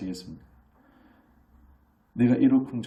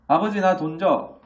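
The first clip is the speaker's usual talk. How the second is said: put-on demanding kid; 8 semitones higher, 7 dB louder, slower than usual. Child demanding money from father.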